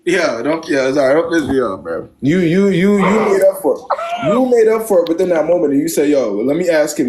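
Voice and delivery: normal talking voice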